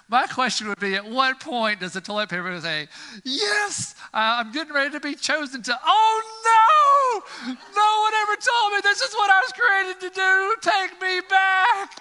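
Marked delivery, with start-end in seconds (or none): 3.3-5.8 very high, excited squeak; thrilled to finally be picked; a squeaky, hopeful roll; eager toilet paper. Pretend. 5.8-12.0 very high, loud wailing scream; hammed-up horror-movie wail; horrified shriek. Toilet paper horrified at its purpose.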